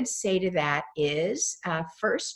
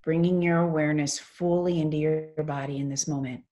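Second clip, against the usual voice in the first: guided meditation. Real, not acted. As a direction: lead them gently into stillness, phrase by phrase.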